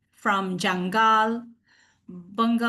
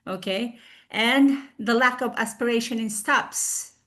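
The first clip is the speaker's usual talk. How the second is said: ordinary talk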